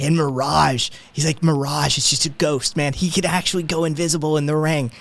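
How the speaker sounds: gushing fan. Acted. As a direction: build up the champion like a legend.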